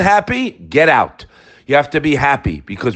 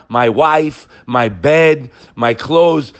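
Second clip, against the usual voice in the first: ordinary talk, nothing marked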